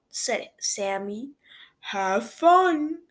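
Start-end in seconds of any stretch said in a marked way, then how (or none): 1.9-3.0 hippo voice. A narrator's reading.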